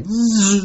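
high, sustained bee zzz; drawn-out mock insect buzz; imitated bee buzz. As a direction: buzz like a bee whizzing past. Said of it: "a bee would buzz by".

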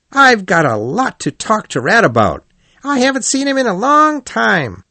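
lively, boastful otter; a bold otter bragging to friends; hearty Otter voice. A narrator's reading. Otter speaks.

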